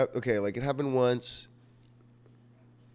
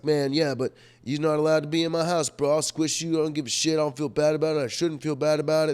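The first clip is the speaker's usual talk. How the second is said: louder, higher and fast defiant rant; heated retort; 6 semitones higher, 5 dB louder than usual. Retold or imitated. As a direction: rattle it off fast and cocky, not backing down. Retold heated debate with friend.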